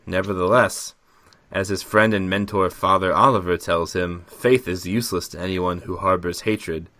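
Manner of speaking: ordinary talk, nothing marked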